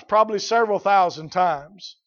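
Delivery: ordinary talk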